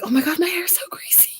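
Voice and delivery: whispered dismay at her hair; panicked whisper. Retold or imitated. Girl whispering in dismay over her greasy hair. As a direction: gasp it under your breath.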